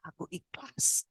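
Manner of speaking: slow, soft whisper; hushed, acted submission; whispered surrender. Surrendering to God in prayer.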